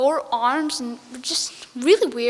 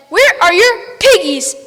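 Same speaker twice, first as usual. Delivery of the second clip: witch demand; 5 semitones higher, 9 dB louder than usual. Acted. Witch demanding piggies from the ducks, high loud witch voice. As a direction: draw it out like a cackling witch.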